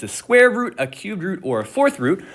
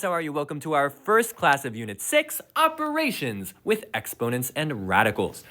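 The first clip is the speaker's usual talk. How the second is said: normal talking voice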